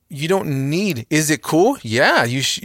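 usual speaking voice

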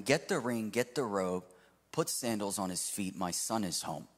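ordinary delivery

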